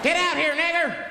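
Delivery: high-pitched mock mob shout; threatening yell. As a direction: holler at someone inside the house. Hooded men yelling outside the house.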